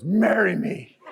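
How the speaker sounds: lightly imitated gruff confidence; gruff, confident suitor. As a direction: propose with a touch of confident gruffness. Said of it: "in his gruff, like, confident voice".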